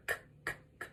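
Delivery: whispered k, k, k; short unvoiced puffs of k; isolated k sound. Shown as a demonstration. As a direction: breathe out k, k, k. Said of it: "what's that very first sound that you hear?".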